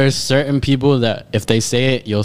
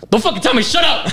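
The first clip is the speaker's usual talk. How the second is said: higher, mocking a friend's angry yell; 7 semitones higher than usual. Friend mimics classmate snapping at being told to shut up, mock angry yell. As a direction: imitate him snapping back in class. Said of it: "and he'd be like".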